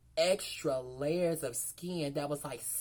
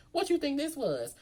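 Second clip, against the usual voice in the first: indignant; 9 semitones higher, 4 dB louder than usual. Retold or imitated. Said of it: "'Cause, girl, like".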